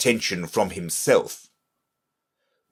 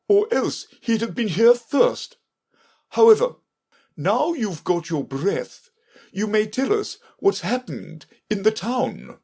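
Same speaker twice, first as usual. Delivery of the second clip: high, emphatic king's voice; high-pitched royal lecture with measured pauses; 11 semitones higher than usual. A narrator's reading. King demanding news from the messenger, imperious.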